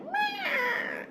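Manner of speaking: very high, drawn-out bird screech; hawk cry imitation. Reader shows viewers what the hawk sounded like, hawk cry imitation. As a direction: mimic a young hawk's piercing call. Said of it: "he was like".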